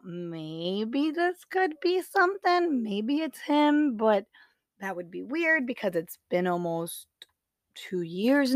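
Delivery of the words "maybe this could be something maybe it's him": high, drawn-out wondering; tentative musing. Retold or imitated. Woman thinking aloud about a mystery texter, tentative musing. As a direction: float it up slowly, entertaining a doubtful idea. Voicing her own doubtful guess.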